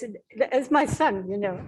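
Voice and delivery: normal talking voice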